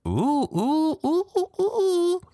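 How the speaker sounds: high teasing oohs; sing-song scandalized ooh; high gossipy ooh. Real, not acted. Reacting to shade in blog.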